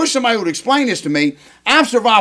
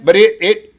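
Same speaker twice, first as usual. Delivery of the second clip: ordinary talk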